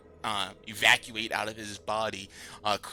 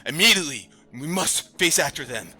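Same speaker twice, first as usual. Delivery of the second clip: urgent command; 4 semitones higher, 5 dB louder than usual. Acted. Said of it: "he's like".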